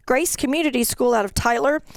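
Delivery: normal talking voice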